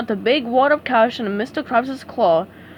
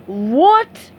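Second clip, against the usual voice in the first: bellow; 3 semitones higher, 6 dB louder than usual. A narrator's reading. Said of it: "he bellowed".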